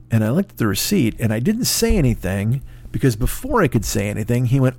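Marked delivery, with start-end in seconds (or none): none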